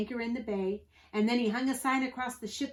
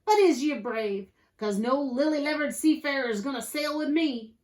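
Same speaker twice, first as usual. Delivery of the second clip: louder, higher pirate quizzing a recruit; Pete, pirate captain; 5 semitones higher, 6 dB louder than usual. A narrator's reading. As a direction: challenge him in pirate talk.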